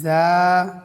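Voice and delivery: loud model জা; Arabic letter za. Shown as a demonstration. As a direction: say জা short and clear. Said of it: "এক আলিফ টান হবে".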